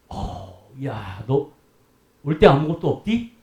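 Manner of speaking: low, hushed, fond voice of Jesus greeting a believer; lightly voiced Jesus asking kindly; gentle Jesus voice. Preacher voicing Jesus to a faithful believer, low and tender. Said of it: "예수님은 뭐라 그러실까?".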